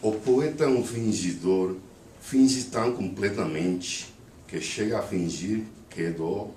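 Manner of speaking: poem recited in Brazilian-accented Portuguese, phrase by phrase. Shown as a demonstration. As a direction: recite with a Brazilian accent, pausing between verses. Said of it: "read the poem with a Brazilian accent".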